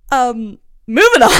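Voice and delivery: loud, high, barely holding back a laugh; through laughter. Real, not acted. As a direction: try to move on while cracking up. Cracking up, tries to move on.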